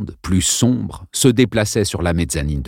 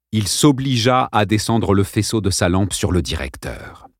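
plain reading voice

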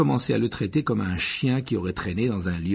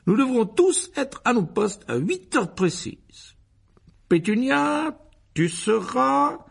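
high, loud, drawn-out officious uncle; pompous Uncle Vernon, commanding; 14 semitones higher, 5 dB louder, slower than usual. A narrator's reading. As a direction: lay down the programme with ceremony. (oncle pompeux qui donne ses ordres)

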